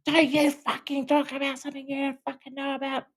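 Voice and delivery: very high, mock furious snap; a wife cutting her husband off in mock fury; imitating the wife lashing out. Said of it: "she would lash out at me".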